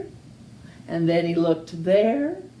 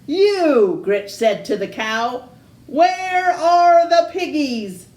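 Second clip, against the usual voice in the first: bossy witch; 7 semitones higher, 5 dB louder than usual. Acted. Witch demands piggies from cow.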